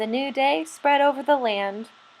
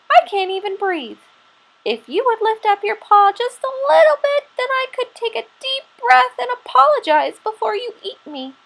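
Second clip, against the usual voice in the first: high, fast squirrel voice pleading; 10 semitones higher than usual. Acted. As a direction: talk your way out, high and hurried. Squirrel pleads under Bear's paw.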